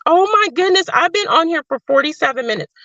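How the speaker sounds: high, fast startled exclaim; suddenly noticing the time; surprised. Real, not acted. Streamer realizing she's been live forty-seven minutes, surprised.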